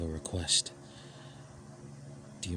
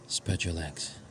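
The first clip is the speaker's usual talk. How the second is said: ordinary talk, nothing marked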